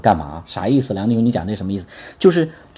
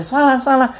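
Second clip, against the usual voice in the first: mock dismissive shrug, pitched much higher; dismissive brush-off; 10 semitones higher, 4 dB louder than usual. Pastor imitating parents who shrug off a child being hit, dismissive. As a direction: wave it off, a couple of hits is nothing. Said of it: "我们中国有时候，有时候教育".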